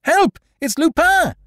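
loud, very high alarm call; shouting. Acted. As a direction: yell for the servants. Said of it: "shouting to the servants".

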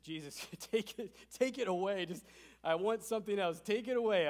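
mock pleading prayer. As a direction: beg Jesus to take it away. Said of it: "I'm just pleading".

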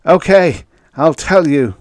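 high, loud yell; yelled surrender. A narrator's reading. Beaten prisoner giving in to his interrogators, yelling. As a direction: cry out as you give in. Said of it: "Finally he yelled".

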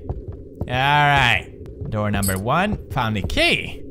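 ordinary delivery

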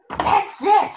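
sudden high, loud burst of discovery; excited cry. Acted. Saggy the elephant finds his answer, high excited cry. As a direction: leap up high as the idea hits. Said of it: "Saggy cried".

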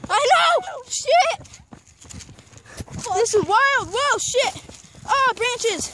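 high, loud panicked yells; screaming while falling. Real, not acted. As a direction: yell as your legs run away from you. Still careening down the slope.

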